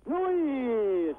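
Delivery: a sudden high yelp; shocked cry. Real, not acted. Shocked exclamation.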